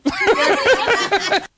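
laughing. Real, not acted. Laughs at own joke.

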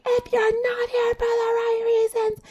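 half-hearted mock scream, way up high; mock yell. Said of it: "Maybe she wasn't yelling like that".

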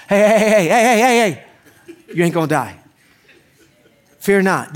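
a mock angel's rushed reassurance; urgent calming call. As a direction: call out to settle a panicking person. Angel calming a terrified person.

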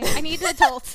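loud, hammed-up cry for help; mock panic. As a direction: play the overwhelmed kid. Playing lost and overwhelmed.

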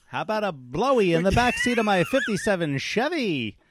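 mock fifties guy's pitch, with laughs; a hammed-up fifties guy asking a girl out; fifties greaser. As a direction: ask her out like a cocky fifties teen.

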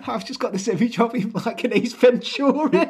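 high-pitched, helpless laughter through the words; squeaking with laughter while telling it; cracking up. Real, not acted. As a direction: talk through a fit of giggles. Cracking up at an imagined scene.

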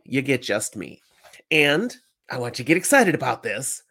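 ordinary talk

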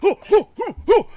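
high, loud mock "oh" cries; imitated startled hoots. Host mimics the man's startled reaction, high mock hoots. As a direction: hoot in exaggerated surprise. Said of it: "He's like".

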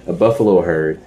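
ordinary delivery

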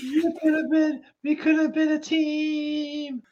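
very high, drawn-out mock wail; sing-song fake sobbing lament; mock crying. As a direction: wail it slowly, hammed-up. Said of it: "Darby Allin's in the back crying".

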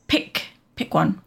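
ordinary delivery

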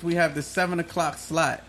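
normal talking voice

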